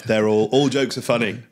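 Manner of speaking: ordinary talk, nothing marked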